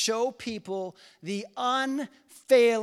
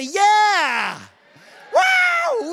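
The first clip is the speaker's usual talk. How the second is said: excited shout; 10 semitones higher, 8 dB louder than usual. Real, not acted. Rallying the congregation.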